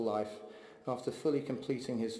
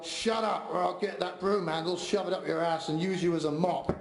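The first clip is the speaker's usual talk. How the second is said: raised, higher-pitched gruff mock yell; Lemmy's gruff yell; 5 semitones higher, 4 dB louder than usual. Son imitating dad threatening the yapping dog, gruff yell. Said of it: "he'd gruffly yell".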